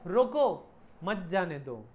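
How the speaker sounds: loud drawn-out "रोको", then "मत जाने दो" joined and falling; pause after रोको. Shown as a demonstration. Teacher offering other reading of radio order, pause after "रोको". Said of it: "या ये समझो".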